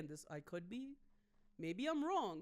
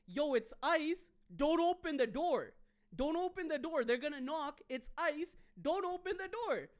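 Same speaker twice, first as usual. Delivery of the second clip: higher, louder warning shout; retold warning yell; 6 semitones higher, 5 dB louder than usual. Man warning the block that ice is here, loud high yell. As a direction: call out to warn the neighbours. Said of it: "starts yelling in Spanish".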